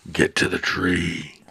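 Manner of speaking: drawn-out, held-back roar of a command; restrained roar. Acted. Sal urges Pixie to flee the creature, restrained roar. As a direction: roar it slowly without full volume. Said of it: "Sal just roars to Pixie".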